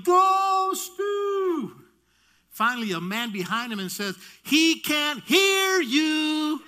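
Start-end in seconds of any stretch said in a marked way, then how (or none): 0.0-1.8 very high, loud, sustained holler; mom's cheering yell. Retold or imitated. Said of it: "She was still yelling". 4.4-6.6 high, loud, slowly stretched words; exasperated bystander yell. Retold or imitated. Man yells at the cheering mom.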